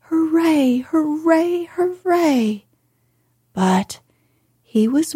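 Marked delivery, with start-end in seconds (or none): 0.1-2.6 high, light mock cheer; imagined cheer. Colin imagining shouting for joy, high mock cheer. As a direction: cheer as if he were strong. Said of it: "would probably have shouted".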